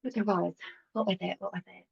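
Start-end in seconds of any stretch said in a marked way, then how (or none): 1.4-1.9 much softer, half under the breath; muttered to herself. Real, not acted. Muttering to herself while trying to remember. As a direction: drop away to yourself as you search.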